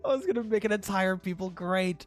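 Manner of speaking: high, loud, cracking up mid-line; laughing while reading. Real, not acted. Streamer reading the DM aloud, laughing through it. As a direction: read it through giggles.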